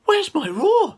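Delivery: lost roar panic; worried little lion. Acted. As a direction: squeak it out like a frightened cub. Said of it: "He thought".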